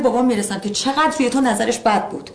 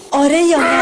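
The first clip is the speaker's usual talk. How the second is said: angry demand; 3 semitones higher, 4 dB louder than usual. Acted. Wife confronting husband about lies.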